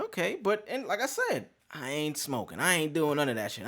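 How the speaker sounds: normal talking voice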